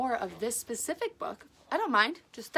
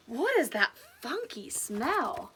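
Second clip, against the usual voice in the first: fox voice; slower than usual. Acted.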